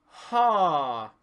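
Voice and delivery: loud open sung ha, no nasal buzz. Shown as a demonstration. Teacher demonstrating a sound with no buzz. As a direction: keep the ha clear of the nose. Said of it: "If you do not have buzz".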